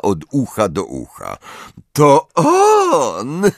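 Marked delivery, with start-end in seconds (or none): 1.9-3.5 high, louder gleeful exclamation; delighted cry. A narrator's reading. Umbridge spots Harry on the floor, delighted. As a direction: crow it with delight. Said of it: "ucieszyła się na widok Harego".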